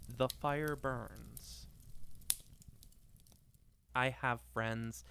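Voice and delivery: ordinary talk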